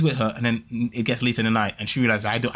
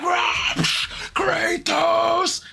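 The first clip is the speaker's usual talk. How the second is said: a much higher yelled war cry, cut short; mock battle cry; much slower than usual. Retelling the fight on the Steam Deck, a high mock battle cry. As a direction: roar the name like you're swinging the axe.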